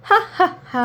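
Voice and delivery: high mocking ha ha ha; scornful storybook laugh. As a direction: laugh the turtle's claim off. Frog mocks the turtle.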